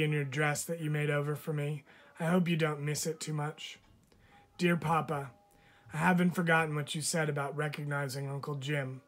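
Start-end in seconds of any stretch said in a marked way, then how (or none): none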